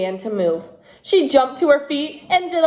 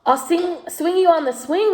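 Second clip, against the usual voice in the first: lightly voiced girl, a bit higher; a small girl trying to please; little girl; 7 semitones higher, 3 dB louder than usual. A narrator's reading. Natasha offers.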